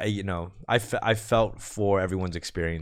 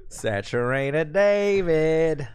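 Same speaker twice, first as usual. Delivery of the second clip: very slow announcer intro of the name; drawn-out announcer; 5 semitones higher, much slower than usual. Shown as a demonstration. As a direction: stretch out Saturated David like a host. Host repeats caller name dramatically.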